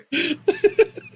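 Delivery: high burst of laughter; loud laugh. Real, not acted. Laughing at the joke.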